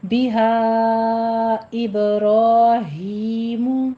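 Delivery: slow بِهَا إِبْرَاهِيمُ with a bounced با and heavy را; tajweed demo. Shown as a demonstration. Teacher recites the phrase slowly. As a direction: draw out إِبْرَاهِيمُ. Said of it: "با کے اوپر قلقلہ کریں گے اور را مفخم".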